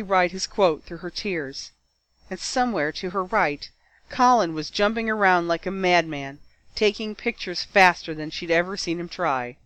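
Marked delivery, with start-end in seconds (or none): none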